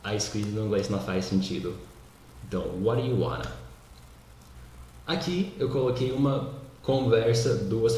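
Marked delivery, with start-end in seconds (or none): 2.8-3.6 quick linked "waddayu-wanna" for "what do you wanna"; fast casual "what do you wanna"; words run together. Shown as a demonstration. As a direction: rattle "what do you wanna" off as one word. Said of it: "juntou todas as palavras".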